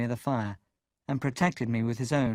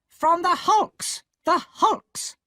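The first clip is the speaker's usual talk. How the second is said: much higher, louder, slow cry; loud answer; 7 dB louder, much slower than usual. A narrator's reading. Sister shouting the answer at Pip. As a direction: shout the word out. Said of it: "my sister answered".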